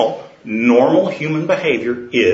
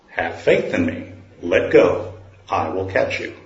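low, much softer, nearly whispered; the Lord's deep voice; 7 semitones lower, 4 dB quieter than usual. Acted. The Lord answers the falling man, low and soft. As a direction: answer from above, low and hushed.

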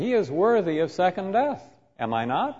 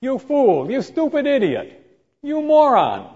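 scornful insults; 9 semitones higher, 4 dB louder than usual. Retold or imitated. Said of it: "to saying".

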